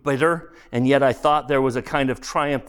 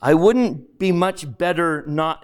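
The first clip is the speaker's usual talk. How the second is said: usual speaking voice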